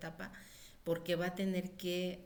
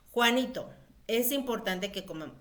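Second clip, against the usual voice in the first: louder, firm, calling Juanito; firm raised voice; 4 semitones higher, 9 dB louder than usual. Shown as a demonstration. Mother firmly telling Juanito it is mealtime, loud and firm. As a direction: put weight and volume in your voice. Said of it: "auméntenle un poquito de volumen a su voz".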